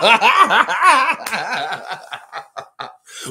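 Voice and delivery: laughing. Real, not acted. Cracks up at own story.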